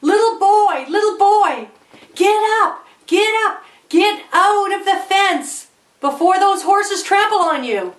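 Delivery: put-on high, urgent shout to a child; screamed warning. Screaming to a little boy near horses, urgent high warning. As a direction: call out in alarm, high and pressing. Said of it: "I screamed to the little boy".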